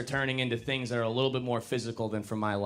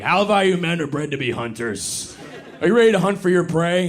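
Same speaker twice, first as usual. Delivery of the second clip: loud hyped-up guru, building louder; alpha male voice; 5 semitones higher, 7 dB louder than usual. Retold or imitated. Comic imitates alpha male influencers, loud hype. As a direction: pump it like a cigar-smoking alpha. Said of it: "guys ... being like".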